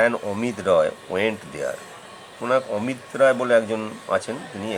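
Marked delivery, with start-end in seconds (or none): 0.1-1.8 1 slow example sentence. Shown as a demonstration. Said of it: "কিংবা ধরো".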